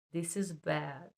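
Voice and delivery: ordinary delivery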